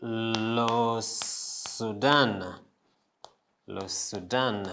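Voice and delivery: LE SUDAN very slow and soft, sounds stretched; French country pronunciation. Shown as a demonstration. Teacher putting Sudan into French, stretched soft demo. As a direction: draw out Le Sudan softly, syllable by syllable. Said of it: "how we're putting it into".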